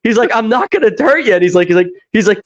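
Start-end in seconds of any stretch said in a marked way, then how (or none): 0.3-1.3 high-pitched, imitated reassurance; acted desperate promise, pitched up; mock pleading. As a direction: plead that you mean no harm. Acted plea in retold film scene.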